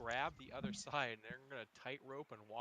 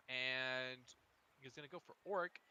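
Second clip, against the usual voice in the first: ordinary delivery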